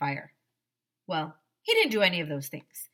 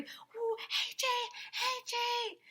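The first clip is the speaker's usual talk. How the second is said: very high, softer hooting; crowd hooting; 5 dB quieter, much slower than usual. A narrator's reading. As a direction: hoot like a cheering crowd. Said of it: "yelling and screaming and shrieking and hooting".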